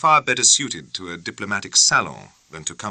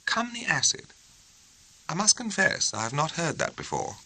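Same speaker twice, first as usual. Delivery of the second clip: higher, softer, puzzled and prim; a prim young barrister puzzling over slang; raised, hushed bewilderment; puzzled proper officer; 10 semitones higher, 5 dB quieter than usual. A narrator's reading. Morrell puzzled by phrase.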